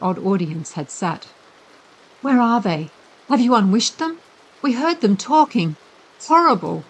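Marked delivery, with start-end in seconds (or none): 2.2-6.8 high, louder anxious questions; scared children rushing at him; frightened. A narrator's reading. Frightened girl asking the boy where the monsters are, high.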